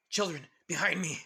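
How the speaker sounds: acted commanding shout; protective command. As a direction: call the children to safety. Protector orders children behind her.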